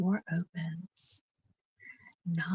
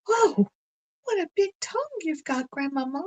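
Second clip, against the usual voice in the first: put-on much higher and louder astonished child; amazed; 10 semitones higher, 6 dB louder, faster than usual. Blanche amazed at the wolf's big tongue, squealing high. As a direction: squeal it out in wide-eyed wonder.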